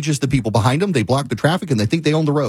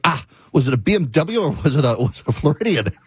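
usual speaking voice

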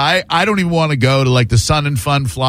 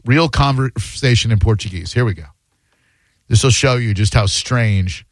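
ordinary talk, nothing marked

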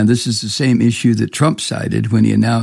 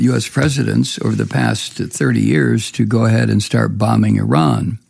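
ordinary talk, nothing marked

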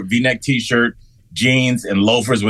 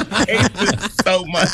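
high, laughing complaint; 9 semitones higher than usual. Real, not acted. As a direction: groan about it through real laughter. Laughing at own camera mishap.

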